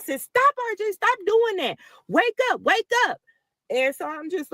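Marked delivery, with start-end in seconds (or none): 0.2-3.2 high, pleading call to wake him; urgent calling. Retold or imitated. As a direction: imitate calling your son awake, pitched high. Mother calls sleeping son awake.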